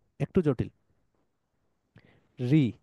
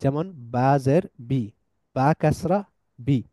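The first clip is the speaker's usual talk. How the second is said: ordinary talk, nothing marked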